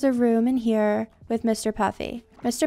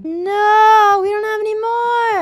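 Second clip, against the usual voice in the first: anguished cry; 10 semitones higher, 11 dB louder than usual. Real, not acted. Runs out of blocks for pet.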